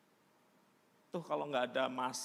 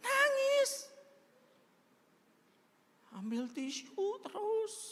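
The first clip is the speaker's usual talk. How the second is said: dramatic telling; 4 dB louder, slower than usual. A narrator's reading. Dramatic retelling of crying.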